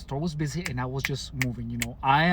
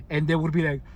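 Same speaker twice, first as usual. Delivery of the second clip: ordinary talk, nothing marked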